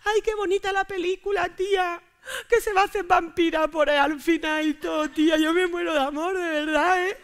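put-on high, sobbing girly gush; gushing teary girl. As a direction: melt over the movie. Girly tearful film gushing. (chica emocionada llorando por la película)

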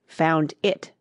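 ordinary delivery, nothing marked

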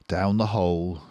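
ordinary delivery, nothing marked

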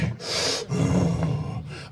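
long, noisy exhale; hefty huffing breath; lineman's heavy breath. Retold or imitated. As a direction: blow out air like a big lineman. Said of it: "gets set in his stance like".

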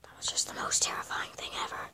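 hushed, breathless whisper. Real, not acted. Player still rattled by the jumpscare, fast frightened whisper. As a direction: barely voice it, still shaken. Shaken after a terrifying jumpscare.

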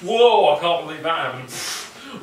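loud, high whoa; mock amazement. Drunk teen retelling the night, hammed-up amazement. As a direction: gush in drunken disbelief.

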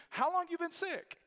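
high, incredulous doctor voice; a lightly voiced doctor asking kindly; doctor's question.

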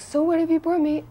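high-pitched expectant question; Prabhupada. Retold or imitated. Guru asking disciple for a gift, high imitated question. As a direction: imitate a teacher asking, lifted well up. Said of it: "He goes".

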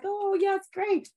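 high, bright oh yeah, it's great; excited reply. Retold or imitated. Thrilled friend agreeing to host, sung out high. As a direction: chirp it back way up high. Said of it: "you're like".

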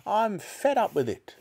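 put-on very high, exasperated old-woman rant; mock each phrase indignantly, drawn out high; grandma shouting. A narrator's reading. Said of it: "shouted Grandma".

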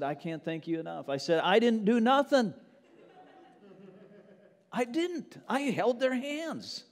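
loud, driving sermon delivery; full-force pulpit preaching. Acted. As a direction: preach it out to the whole room. Preaching to congregation.

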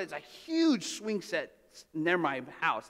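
ordinary talk

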